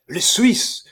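high, loud warning; alarmed cry. A narrator's reading. Breaking alarm news, running in.

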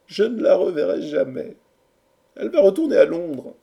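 high, loud theatrical woe; mournful lament. A narrator's reading. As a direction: lament like a hopeless lover. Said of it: "versa le punch en soupirant". (lamentation amoureuse, haute et forte)

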